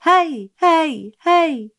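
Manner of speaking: high, loud shouted heys; calling out. A narrator's reading. Child shouting at the schoolhouse wall, calling out. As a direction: shout to raise an echo.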